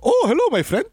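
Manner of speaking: hammed-up Polish accent greeting. Guest greets the host in a mock Polish accent. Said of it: "That was my Polish accent?".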